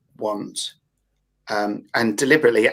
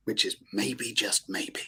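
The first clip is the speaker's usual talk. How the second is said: softer and softer, trailing into a breathy whisper; teasing hush; 3 semitones lower, 9 dB quieter, slower than usual. Acted. Speaker teasing the allure of dopamine-driven want, hushed. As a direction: dangle the maybe like bait, fading out.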